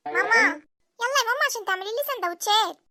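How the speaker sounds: put-on high-pitched, insistent child's call; child calling mum. Child wants mum to make sandwiches, calling out.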